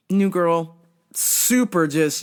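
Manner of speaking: ordinary delivery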